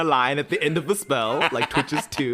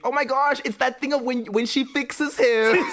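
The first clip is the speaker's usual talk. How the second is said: mock gushing; 12 semitones higher, 3 dB louder than usual. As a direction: swoon over the idea of fixing him. Mocking viewers who romanticise him.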